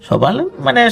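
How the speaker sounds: usual speaking voice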